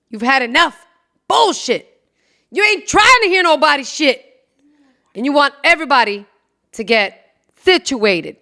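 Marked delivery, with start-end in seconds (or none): 1.3-4.2 fed-up. Retold or imitated. Acting fed-up parent.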